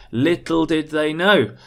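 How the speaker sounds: LITTLE DID THEY KNOW punched out loud; dramatic stress, loud on LITTLE and KNOW; actorly emphasis. Shown as a demonstration. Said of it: "be a little bit of an actor".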